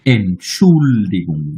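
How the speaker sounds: drawn out, each syllable of Entschuldigung spelled out slowly; slow German demo. Shown as a demonstration. Teacher showing students the word, slow and careful. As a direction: model Entschuldigung very slowly for the class. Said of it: "এই উচ্চারণটা".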